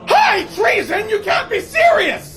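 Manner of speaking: high, loud, slow disbelieving plea; panicked shout. Acted. Tavern keeper hearing the death sentence, panicked and loud. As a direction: cry out in horror at the sentence.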